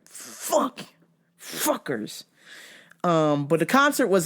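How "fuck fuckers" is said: muttered curse. Retold or imitated. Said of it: "I was like".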